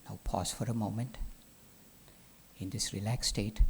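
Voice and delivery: low, measured meditation voice with long pauses; guided relaxation. Acted. Teacher relaxing the class part by part, guided relaxation. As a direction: guide them softly through letting go.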